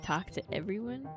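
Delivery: ordinary delivery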